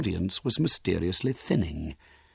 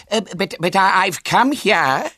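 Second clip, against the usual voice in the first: high, loud stammering objection; raised voice, fumbling for words; flustered protest; 11 semitones higher, 7 dB louder than usual. Acted. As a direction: stammer in bewildered indignation. Bewildered, horrified professor begins to protest.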